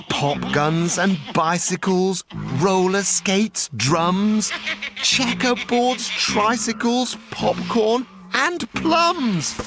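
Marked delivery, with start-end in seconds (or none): none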